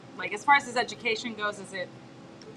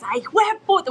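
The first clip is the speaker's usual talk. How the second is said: high, loud "hijo de puta" full of feeling; emotional cursing; 10 semitones higher, 5 dB louder, faster than usual. Retold or imitated. Godmother who curses constantly, imitated with so much emotion. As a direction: imitate a huge curser's emphatic outburst. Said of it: "with, like, so much emotion".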